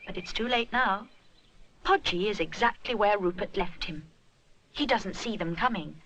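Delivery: usual speaking voice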